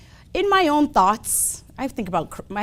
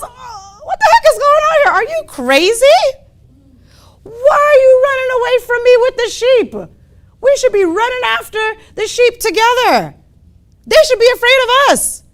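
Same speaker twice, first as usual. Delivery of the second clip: high, loud, outraged lion; incredulous scolding; 13 semitones higher, 9 dB louder, faster than usual. Acted. Older lion to the runaway cub, incredulous scolding. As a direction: shout in high-pitched disbelief, rushing at first.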